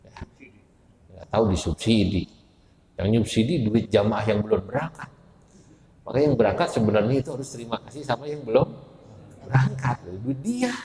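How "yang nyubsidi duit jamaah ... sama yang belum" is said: usual speaking voice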